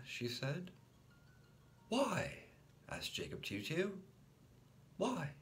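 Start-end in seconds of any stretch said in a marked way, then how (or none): 1.9-2.4 put-on high little-boy question; high childlike why; small child voice. A narrator's reading. 5.0-5.3 put-on high little-boy question; high childlike why; small child voice. A narrator's reading.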